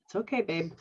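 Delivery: hushed, tender comfort; soothing. Real, not acted. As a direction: soothe her gently, almost whispering. Comforting a crying client.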